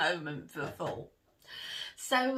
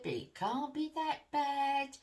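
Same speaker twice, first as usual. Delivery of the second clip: imitating mum's kindly voice, higher; 9 semitones higher than usual. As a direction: soothe like a mum on the phone. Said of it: "you know, that kindly voice".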